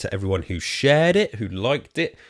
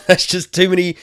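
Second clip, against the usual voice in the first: laughing; 10 semitones higher, 6 dB louder than usual. Real, not acted. Laughing at own rude joke.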